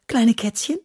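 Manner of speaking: high, eager little question; excited child asking; eager guess. A narrator's reading.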